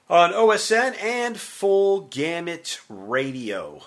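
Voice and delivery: slow, high, loud announcer sign-off; radio outro. Acted. Host signing off the show, big announcer voice. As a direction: stretch out the station name like a DJ.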